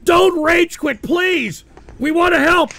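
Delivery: loud, high mock pleading; pleading shout. Real, not acted. Streamer begging the woman not to rage quit, loud. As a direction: beg her loudly not to quit.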